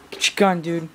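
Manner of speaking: usual speaking voice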